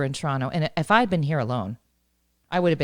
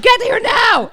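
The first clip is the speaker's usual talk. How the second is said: high, loud panicked demand; re-enacted freak-out; 7 dB louder, slower than usual. Phoning a friend about a hatched chick, panicked yell. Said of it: "I'm freaking out".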